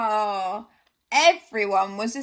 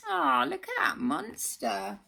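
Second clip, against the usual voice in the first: ordinary delivery, nothing marked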